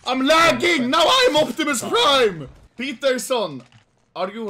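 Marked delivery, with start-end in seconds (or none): 0.1-2.5 raised, loud complaint; high and loud, worked up; excited yelling. Real, not acted. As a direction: shout it out in amused alarm. Shouting about own stream lag.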